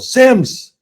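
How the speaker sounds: high, loud call of the surname; imitated coach's call. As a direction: call out sharply to the player, as the coach. Coach calling him from sideline.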